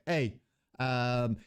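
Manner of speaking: ordinary talk, nothing marked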